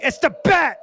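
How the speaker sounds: put-on high, loud old-timey goon yell; 1920s goon shout. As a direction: bellow it like a movie henchman. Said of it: "like, the Batman, like, goon".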